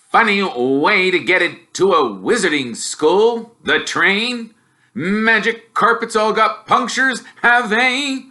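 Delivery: mock the boy's wizard school; scornful Uncle Vernon jeering; sneering uncle. Uncle mocks Harry.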